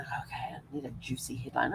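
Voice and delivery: whispered, scheming aside; whisper to self. Retold or imitated. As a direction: hush it like a plotter. Scheming at the checkout.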